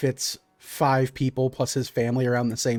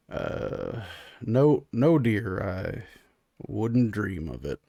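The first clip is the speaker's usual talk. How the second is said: low, slow, hesitant denial; meek husband; 5 semitones lower, 4 dB quieter, much slower than usual. Acted. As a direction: back down softly and carefully. Clyde backs down to his wife.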